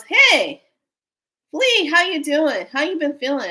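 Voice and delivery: high, loud, drawn-out hello; imitated upbeat caller, pitched well up; cheery phone greeting. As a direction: greet an old friend with lifted voice.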